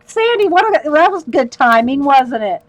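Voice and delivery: high, loud teasing imitation; high, loud teasing impression of a man; quoting him. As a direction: mimic his teasing remark, loud. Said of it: "he just looked at me and said".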